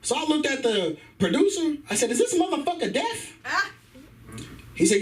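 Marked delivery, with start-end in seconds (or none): 2.1-3.4 high, incredulous question; mock-outraged disbelief; baffled complaint. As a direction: ask it high, as if you can't believe it.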